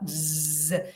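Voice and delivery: soft buzzing Z; Z as a voiced S; voiced Z sound. Shown as a demonstration. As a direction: buzz the Z quietly. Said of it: "La Z representa la S sonora".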